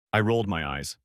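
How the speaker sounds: ordinary delivery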